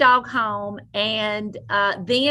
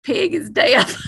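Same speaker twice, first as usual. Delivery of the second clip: retold exclamation; 5 semitones higher, 4 dB louder than usual. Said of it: "And so she said".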